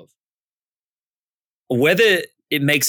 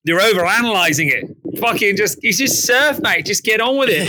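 put-on jokey Aussie bloke accent, pitched up; mock Aussie accent; 6 semitones higher than usual. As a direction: play a laid-back Aussie mate brushing it off. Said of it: "we always do an Aussie accent".

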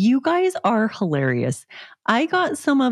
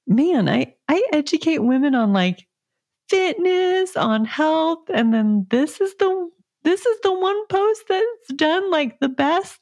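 excited; 8 semitones higher than usual. Retold or imitated. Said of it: "I told my husband".

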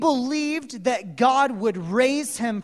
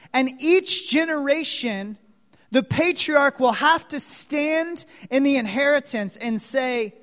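ordinary talk

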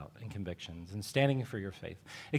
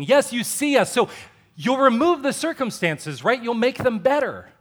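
pleading prayer; 7 semitones higher, 8 dB louder than usual. Retold or imitated. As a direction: beg Jesus ever more loudly to fix it. Congregant pleading with Jesus in prayer.

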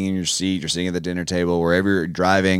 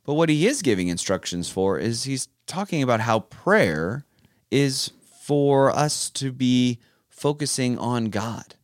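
usual speaking voice

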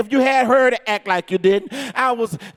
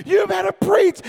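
high, hollered egging-on, imitated; imitated urging; 7 semitones higher than usual. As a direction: mimic a wife cheering the preacher on. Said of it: "And she's like".

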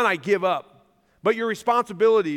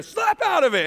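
mock yell; 10 semitones higher, 3 dB louder than usual. Joab shaking her in clip.

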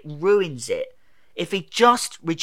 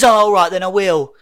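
loud, raised, sarcastic agreement; 5 semitones higher, 9 dB louder than usual. Retold or imitated. The Doctor agreeing to save Wilf, sarcastic. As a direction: give in with exaggerated sarcasm. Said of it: "like, sarcastically".

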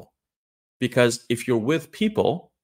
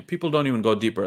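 ordinary talk, nothing marked

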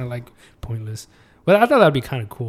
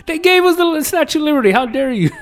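mock indignation; 11 semitones higher, 3 dB louder than usual. Mock outrage defending France.

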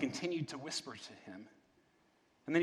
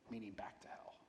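much softer, almost whispered aside; hushed aside; 6 semitones lower, 16 dB quieter than usual. A narrator's reading. Reader explaining where the ghost goes, hushed aside. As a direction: drop to a near whisper. Grim aside ending the quote.

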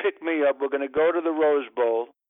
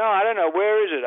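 whiny Larry; 5 semitones higher, 4 dB louder, faster than usual. Retold or imitated. Larry admits losing pass.

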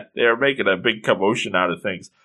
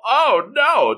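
high-pitched mock dismay; high and mighty; 12 semitones higher than usual. High and mighty city woman fussing, mock indignant. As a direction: wail it like a snooty stepsister. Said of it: "all high and mighty and all this".

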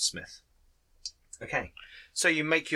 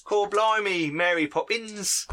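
mock Cockney accent; 5 semitones higher, slower than usual. Game master joking about urchin kids, hammed-up Cockney accent. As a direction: ham up a bad stage Cockney. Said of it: "Cockney accent".

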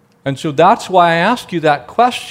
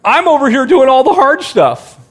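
mock whiny, raised and louder; whiny complaint; 8 semitones higher, 4 dB louder than usual. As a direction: whine about doing all the work. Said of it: "kinda sounds a little whiny".

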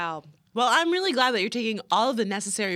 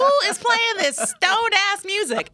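high, louder baffled question; baffled roommate; 9 semitones higher, 5 dB louder than usual. Retold or imitated. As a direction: act out the confused roommate. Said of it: "you're gonna be like".

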